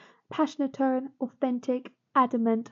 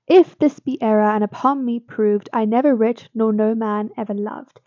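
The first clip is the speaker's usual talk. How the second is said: louder, declaimed closing couplet; poem recitation; 6 dB louder than usual. Acted. Teacher reading the final couplet aloud, louder and declaimed. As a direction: land the couplet like a verdict. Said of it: "we get the rhyming couplet".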